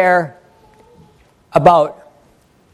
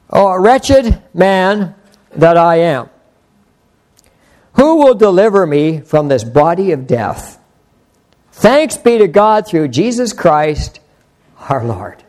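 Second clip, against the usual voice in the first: slow, emphatic Bible reading; scripture reading; slower than usual. Acted. Preacher reading Romans to the congregation, slow declaimed scripture. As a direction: declaim the verse with weighty pauses. Reads the verse aloud.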